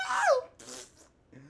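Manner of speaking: squeaky high-pitched "No!" as the sign hits him; hammed-up villain screaming his defeat; mock villain cry. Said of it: "He's like".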